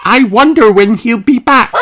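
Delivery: happy mum sharing good news; Mummy Dog. A narrator's reading. Mummy Dog telling Danny his daddy is coming home, high happy voice. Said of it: "asks Mummy Dog".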